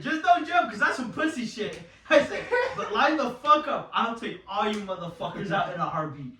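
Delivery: high, laughing bravado; retelling his challenge to the other team; laughing mock threat. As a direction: dare them while cracking up.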